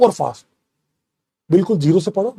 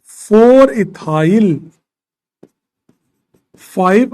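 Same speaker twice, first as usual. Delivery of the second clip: slow dictation; 4 dB louder, much slower than usual. Shown as a demonstration. Teacher dictating the name.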